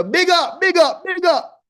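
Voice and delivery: high, loud Jamaican "big up, big up". Real, not acted. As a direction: hype it up Jamaican style. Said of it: "my accent now".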